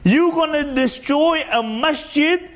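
high-pitched, slow indignant protest; up in arms. Retold or imitated.

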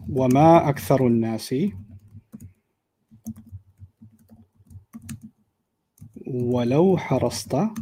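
تلاوة مرتلة; Quran recitation. Shown as a demonstration. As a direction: chant the ayah in tajweed. Reciting Quran ayah in class.

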